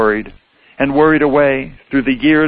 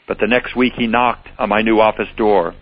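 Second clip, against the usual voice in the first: plain reading voice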